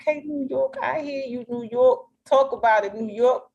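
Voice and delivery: high, playful hype voice with a hint of New York; mock New York cheer. Teasing a friend about her New York twang, high playful cheer. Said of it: "twang in your voice".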